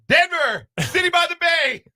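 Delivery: very high, loud quoted movie line; mock announcer shout. Host throwing a movie quote into co-host's list, high mock shout. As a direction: belt it out high, imitating a film.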